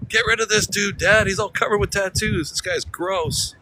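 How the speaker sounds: high-pitched voice of a complaining dog; mock disgusted dog. Dog complains to its dad about the tattooed stranger, high mock disgust. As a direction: pretend to be a dog griping in a high voice.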